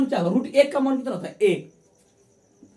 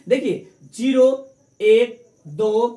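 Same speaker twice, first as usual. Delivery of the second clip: louder, very slow counting, each number hammered; slow drilled count; 5 dB louder, slower than usual. Shown as a demonstration. Teacher drilling the class on the trick, loud and slow. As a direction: chant zero, एक, दो one by one like a drill. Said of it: "बस इतने trick है".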